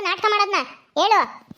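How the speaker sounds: usual speaking voice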